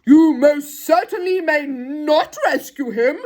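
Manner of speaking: slow, loud, emphatic refusal; stern officer drawing out each word crossly; cross policeman. Acted. Policeman forbids the children a rescue, cross. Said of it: "said the policeman crossly".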